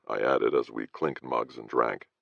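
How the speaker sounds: ordinary talk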